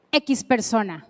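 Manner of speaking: ordinary delivery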